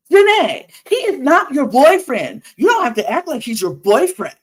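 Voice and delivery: loud, high, scolding protest; hammed-up offended telling-off; acted indignant outburst. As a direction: imitate her snapping at a rival. Acts out Leah's quarrel outburst.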